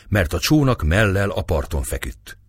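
ordinary delivery, nothing marked

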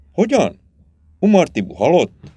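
high-pitched, shocked questioning; startled double question, rising at the end; startled disbelief. A narrator's reading. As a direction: react to unbelievable news, pitch jumping up. Startled by news of a death.

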